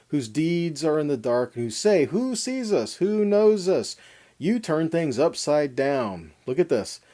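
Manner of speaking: normal talking voice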